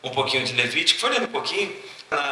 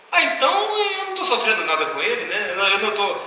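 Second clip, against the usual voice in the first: surprised realization; 8 semitones higher than usual. Retold or imitated. Man realizes, voiced in story.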